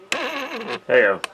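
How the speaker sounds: ordinary delivery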